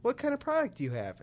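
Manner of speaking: high, sing-song robotic prompt voice; imitating automated phone menu. Describing Apple's support line, mimicking its automated voice prompt. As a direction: read it like a cheery voice-recognition system. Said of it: "it goes".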